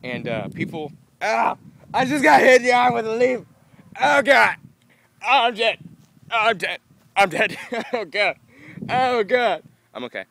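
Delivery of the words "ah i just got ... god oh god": much higher, louder mock-dying wails; over-the-top cries of doom at a leaf; dramatic overreaction. Real, not acted. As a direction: ham up being hit by a leaf. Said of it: "That was really dramatic".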